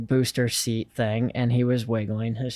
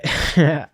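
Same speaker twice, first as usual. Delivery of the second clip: short laugh; 4 semitones higher, 8 dB louder than usual. Real, not acted. Laughing at his own disbelief.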